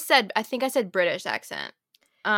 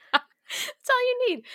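laughing; slower than usual. Real, not acted. Laughing reply to the tease.

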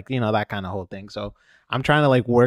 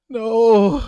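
shocked no; 12 semitones higher, 7 dB louder than usual. Retold or imitated. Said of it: "I was like".

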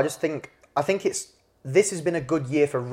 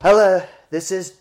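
higher, louder mock Trump on the phone; Trump impression; 4 semitones higher, 6 dB louder, slower than usual. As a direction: put on a hammed-up Trump voice for a phone call. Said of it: "I'm Donald Trump".

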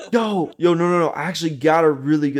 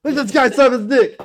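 rising, higher calls to the office; excited call; 6 semitones higher, 4 dB louder than usual. Pretend. Boss calling Karen and coworkers to the camera, excited and high. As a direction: call everyone over, getting more excited. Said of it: "half the fucking office building".

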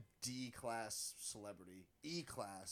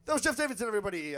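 loud, high host announcement; announcer shout; 8 semitones higher, 12 dB louder, much faster than usual. Acted. Podcast host sends off his guest, loud announcer style. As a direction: hype the crowd like an MC.

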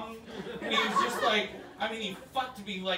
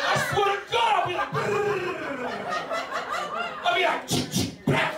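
very high, loud hammed-up threat with gun noises; angry rapper; 12 semitones higher, 5 dB louder than usual. Grown men in the studio making gun noises, high and loud. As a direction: squeal the threat then click the gun. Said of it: "they're like".

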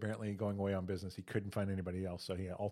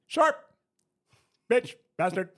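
sudden shouted outbursts, much higher and louder; mock Tourette's outburst; 14 semitones higher, 13 dB louder than usual. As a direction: blurt out the words in a loud yell. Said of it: "golf announcer who has Tourette's".

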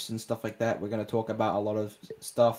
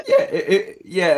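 voice crack; 8 semitones higher, 10 dB louder than usual. Real, not acted. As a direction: voice cracking up high. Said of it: "that's a voice crack".

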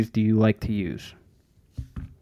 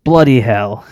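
loud mock-British exclamation; British accent; 10 dB louder, slower than usual. Host offers co-host a phrase from Britain, loud British accent. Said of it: "they say in Britain all the time".

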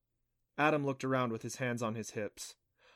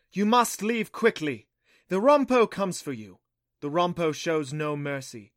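loud, solemn oracle ringing out; heavy goddess voice; 3 semitones higher, 6 dB louder than usual. A narrator's reading. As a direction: proclaim a dire warning to the whole cavern. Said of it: "the same heavy voice".

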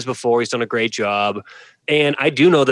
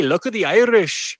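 bad Irish accent; 6 semitones higher than usual. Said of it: "your Irish accent needs some work".

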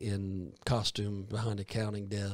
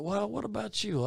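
curious question; 11 semitones higher, 3 dB louder than usual. Retold or imitated. Voicing people in heaven.

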